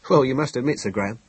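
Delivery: ordinary delivery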